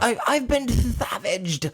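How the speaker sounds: very high, slow, hammed-up lisp; drawn-out "savaged" with a lisped s, high-pitched; Vera. Host repeating the bitten woman's line, high weird lisp. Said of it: "like, this weird lisp".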